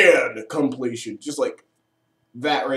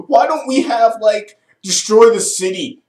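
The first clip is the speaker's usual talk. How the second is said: exasperated villain; 5 semitones higher, 6 dB louder than usual. Retold or imitated. Reviewer mocks the Rage villain's complaint, loud exasperation. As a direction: play the fed-up Rage villain losing it. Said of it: "the Lieutenant of Rage is just like".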